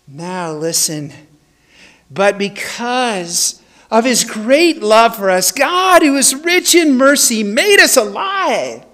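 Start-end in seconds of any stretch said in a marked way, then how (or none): 2.1-8.8 preaching emphasis. Real, not acted. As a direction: proclaim it with full force. Preaching climax of scripture.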